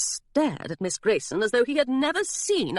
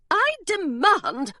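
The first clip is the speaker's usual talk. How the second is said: high, slow, imperious demand; 10 semitones higher, much slower than usual. Acted. Governess at the door demanding entry, high and slow. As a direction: stretch each word out like an order.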